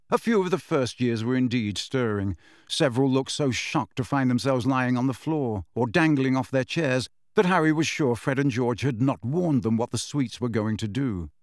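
plain reading voice

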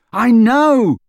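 furious high shout; bellowed. A narrator's reading. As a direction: bellow it in frustration. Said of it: "Harry bellowed".

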